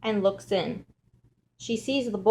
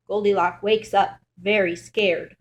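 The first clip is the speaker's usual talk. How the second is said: usual speaking voice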